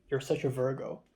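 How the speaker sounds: usual speaking voice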